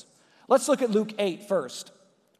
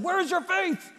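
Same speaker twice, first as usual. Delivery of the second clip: much higher, exasperated snap; higher pitched, annoyed rebuke; mock angry yell; 9 semitones higher, 3 dB quieter than usual. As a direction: fire it at them like an irritated teacher. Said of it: "Jesus may have yelled at them".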